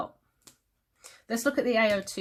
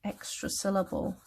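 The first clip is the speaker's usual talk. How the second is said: very slow, drawn-out words; dictating while writing; 3 dB quieter, slower than usual. Shown as a demonstration. Teacher writing a note, very slow drawn-out speech. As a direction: spell it out slowly as you write. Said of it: "I'm gonna write that".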